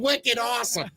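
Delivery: high-pitched put-on Boston accent; mock Boston accent. Echoing the friend's Boston woman bit, mock Boston accent. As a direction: have a rough go at imitating Boston. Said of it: "I can't do it that good".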